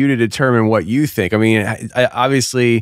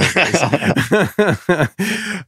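laughing; 5 semitones higher than usual. Real, not acted. Host laughing at own joke.

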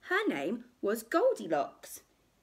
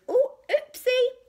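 put-on high, loud little-girl oops; playful high-pitched oopsie; cheeky giggly girl, much higher and louder; giggly Goldilocks; 9 dB louder than usual. A narrator's reading. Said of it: "giggled Goldilocks".

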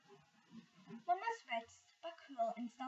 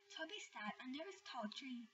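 plain reading voice

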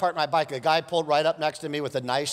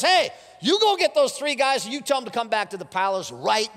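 mock angry king; 8 semitones higher, 3 dB louder than usual. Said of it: "He's mad, so he says".

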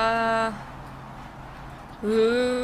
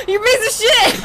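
laughing yell; 9 semitones higher, 5 dB louder than usual. Real, not acted. Yelling at the balloon, laughing.

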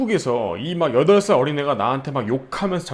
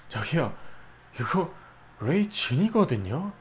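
softer, slow, condescending politeness; snide polite correction; 8 dB quieter than usual. Retold or imitated. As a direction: correct him with icy courtesy. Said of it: "나한테 그렇게".

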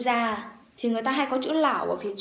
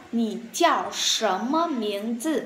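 usual speaking voice

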